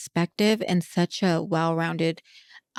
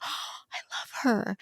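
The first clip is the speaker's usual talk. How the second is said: soft, breathy retold swoon; swooning admiration; 3 semitones higher, 7 dB quieter than usual. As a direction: act out melting with adoration, almost voiceless. Swooning over an accent.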